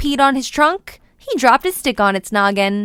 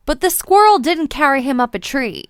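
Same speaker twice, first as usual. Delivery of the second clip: ordinary delivery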